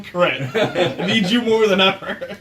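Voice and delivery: ordinary talk, nothing marked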